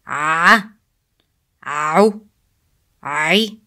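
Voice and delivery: ayn gliding into "A", "Ow", "I"; ain plus following sound. Shown as a demonstration. Teacher adding sounds after ain, pharyngeal onset. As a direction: start "A", "Ow", "I" mid-throat. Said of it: "Then add the following sound".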